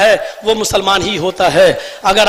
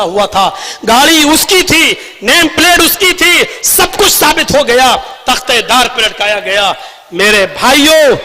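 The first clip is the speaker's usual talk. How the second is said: impassioned rally speech with ringing emphasis; fiery oratory; 5 semitones higher, 4 dB louder than usual. Real, not acted. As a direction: address the crowd like a fiery orator. Impassioned political speech to a crowd.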